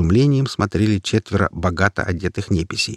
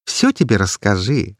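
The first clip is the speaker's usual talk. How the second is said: ordinary delivery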